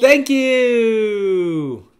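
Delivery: bright high thank-you, called out; high excited thanks. Acted. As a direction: thank them in a high cheer. Sing-song donation thanks.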